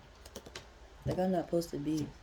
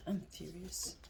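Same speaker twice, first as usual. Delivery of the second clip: quiet intensity; 10 dB quieter, slower than usual. Real, not acted. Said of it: "I'm not even mad".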